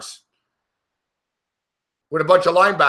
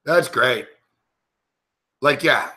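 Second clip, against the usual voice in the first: lower, dry, deadpan sarcastic praise; 5 semitones lower, slower than usual. Real, not acted. Fan repeating it to the TV, flatter sarcasm. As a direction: say it flat and disgusted.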